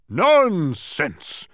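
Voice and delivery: high, loud outraged bellow. A narrator's reading. Mathematician dismissing Milo's doubt, bellowing. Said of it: "bellowed the mathematician".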